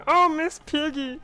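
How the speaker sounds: high, put-on frog doll moan; hammed-up Kermit, carried away. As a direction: cry out as the puppet frog. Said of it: "that's my Kermit voice".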